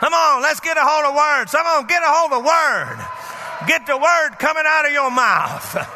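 urgent preacher's call; preaching at full force. Acted. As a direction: rouse the congregation.

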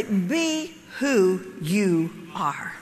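slow, low, word by word; spaced-out words, stepping down in pitch; slow and deliberate. Real, not acted. Speaker hands the audience her key line, slow and deliberate.

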